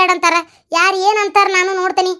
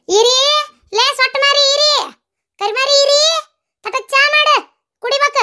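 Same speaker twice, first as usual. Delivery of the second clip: calling for tea; 9 semitones higher than usual. Acted. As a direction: shout across the house in a high put-on voice. Calling the daughter-in-law for tea. (ಎತ್ತರದ ಧ್ವನಿಯಲ್ಲಿ ಕೂಗಿ ಕರೆಯುವುದು)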